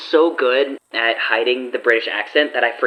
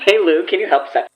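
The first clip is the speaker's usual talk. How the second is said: higher, pleading call to a friend; play-acted, raised-pitch appeal; playful mock request; 7 semitones higher, 3 dB louder than usual. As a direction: jokingly coax a favour out of someone. Mimics the acted request to Lou.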